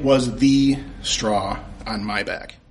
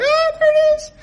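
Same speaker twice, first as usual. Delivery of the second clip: soaring falsetto awe, much higher; heavenly discovery; 3 dB louder than usual. Retold or imitated. A fan spots the DVD, high falsetto awe. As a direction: sing it out like angels found it. Finding season two in store.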